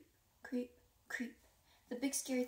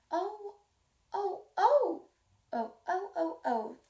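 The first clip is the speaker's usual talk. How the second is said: high, loud pained yelps; yelling oh oh; 7 semitones higher, 7 dB louder, slower than usual. A narrator's reading. Little lion bitten on the tail, yelping. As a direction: yelp it out in pain. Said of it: "yelled Johnny Lion".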